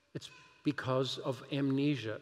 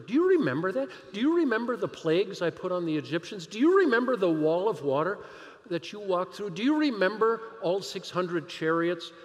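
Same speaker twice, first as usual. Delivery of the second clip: rising preaching; 4 semitones higher, 5 dB louder than usual. Acted. Preaching builds to a climax.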